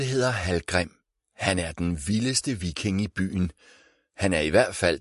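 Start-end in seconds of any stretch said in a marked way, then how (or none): none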